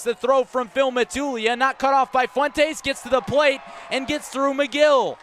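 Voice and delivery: roaring play-by-play as the runner slides in safely; excited home run call. Real, not acted. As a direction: call the bouncing ball and the run home at full voice. Big play, run scores.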